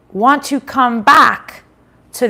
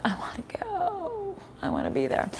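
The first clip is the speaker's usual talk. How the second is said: wistful whisper; 11 semitones higher, 14 dB quieter than usual. Retold or imitated. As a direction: breathe it like a wish. Longing quote about warm places.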